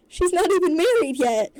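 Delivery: loud, high-pitched outburst; shrieked dad outrage; appalled cry. A narrator's reading. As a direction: wail it in scandalized protest. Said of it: "Randy cries, holding his hand to his face".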